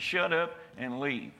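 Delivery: ordinary talk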